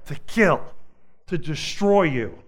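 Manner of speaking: loud rising preacher's call against the devil; impassioned sermon delivery; fiery preaching. Acted. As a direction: build it up from the pulpit. Preaching at full force.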